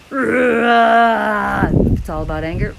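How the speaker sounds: loud slow angry wail; loud, slow venting cry of anger; anger release. Shown as a demonstration. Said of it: "one more".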